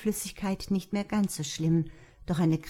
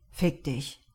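plain reading voice

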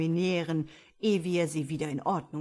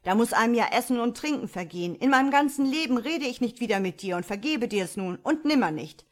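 loud, raised tirade at a sister; donnernd; 5 semitones higher, 7 dB louder, faster than usual. A narrator's reading. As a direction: rage on at full voice, pitched up. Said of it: "donnerte Karl". (laut und erregt donnernd)

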